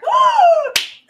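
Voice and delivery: high, loud gasp for air; sudden huge gasp, surfacing from the water. Retold or imitated. Missy grabbing onto the boat, loud gasp. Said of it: "And then you just hear".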